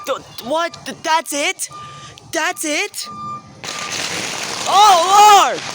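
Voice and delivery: high, loud startled yell; startled shout. Real, not acted. Vlogger reacting to sudden firework, startled shout. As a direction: holler out as the blast goes off.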